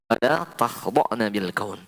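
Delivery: slow, measured recitation of the verse; Quran recitation. Shown as a demonstration. Preacher reciting the verse to the women, slow. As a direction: recite it slowly and carefully. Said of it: "Pegang ini baik-baik".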